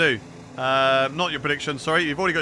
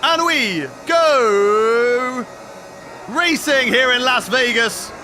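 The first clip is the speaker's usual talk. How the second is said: loud, raised-pitch play-by-play; live race commentary; 5 semitones higher, 4 dB louder than usual. Acted. Streamer commentating his own race start, excited play-by-play. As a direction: call the race with rising excitement.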